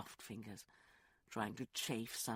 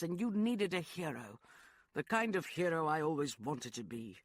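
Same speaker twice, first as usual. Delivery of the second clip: Lance, impassioned; 5 semitones higher, 5 dB louder than usual. Acted. Knight confesses the dream he built, lightly impassioned. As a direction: pour out the dream he made for her.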